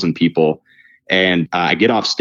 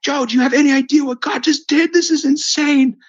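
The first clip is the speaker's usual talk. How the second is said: much higher, frantic squeal of disbelief; ecstatic shriek. Retold or imitated. Yelling to Joe right after his leg healed, ecstatic shriek. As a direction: shriek it out like you just saw a miracle. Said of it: "I'm literally screaming".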